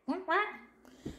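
high, soft little quacks; duck quack. Pretend. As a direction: quack quietly up high like a duckling. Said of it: "duck says quack".

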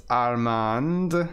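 ordinary talk, nothing marked

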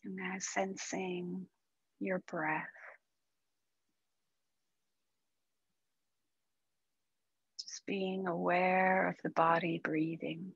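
calm, unhurried meditation guide with long pauses; guided meditation. Acted. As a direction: ease them gently into stillness, softening as it goes.

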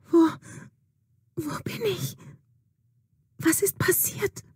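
put-on fainted girl coming to, barely audible. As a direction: barely breathe the words, just waking. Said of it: "mit kaum hörbarer Stimme".

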